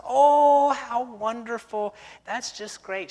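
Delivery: high, loud gushing oh, then slow; mock delighted church lady. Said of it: "She said".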